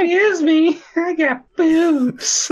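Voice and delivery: very high mock-female falsetto; female Abysme. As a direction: squeak it out in a put-on woman's voice. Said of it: "Female Abysme now".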